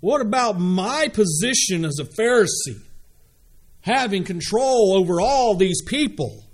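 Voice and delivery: high-pitched, self-important protest; selfish Pharisee. Acted. Pharisee worried about his own position, selfish high-pitched protest. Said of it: "They're a little bit selfish".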